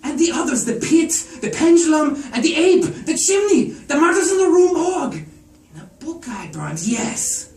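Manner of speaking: very high and loud, rising in alarm; frantic recognition. Acted. Garrett recognizing scenes from burned books, frantic and high. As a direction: blurt it as the horror dawns.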